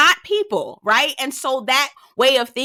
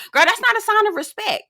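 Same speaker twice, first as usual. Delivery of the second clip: high, indignant correction; 10 semitones higher than usual. Real, not acted. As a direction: pitch up, scolding.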